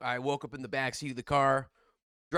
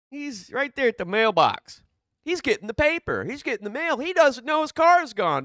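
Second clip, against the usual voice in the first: high, loud and keyed up; excited telling; 9 semitones higher, 5 dB louder than usual. Retold or imitated. Telling how grandpa waited at the mailbox, keyed up and high. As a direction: build the alarm of the moment.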